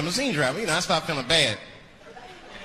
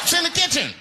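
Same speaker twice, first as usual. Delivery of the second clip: much higher deadpan; high sarcastic reply; 12 semitones higher, 3 dB louder than usual. Acted. Tenant answering the landlord's lie, high and sarcastic. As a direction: say it high and pointed.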